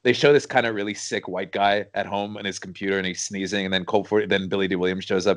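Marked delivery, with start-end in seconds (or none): none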